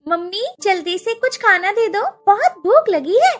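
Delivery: put-on high childish plea for food; little girl voice. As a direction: whine like a hungry little girl. (भूखी छोटी बच्ची की आवाज़)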